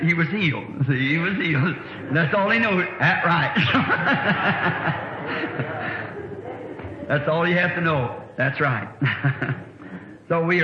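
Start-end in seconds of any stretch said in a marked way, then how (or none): 3.6-7.0 amused laughter; breathy laugh. Real, not acted. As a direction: chuckle at the punchline. Preacher laughs at his story.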